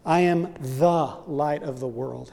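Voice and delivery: slow, with the first "the" drawn out before "light"; stress on THE. Shown as a demonstration. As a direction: lean on "the" in "the light". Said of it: "have definite articles".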